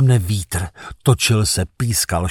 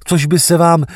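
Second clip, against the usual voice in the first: ordinary delivery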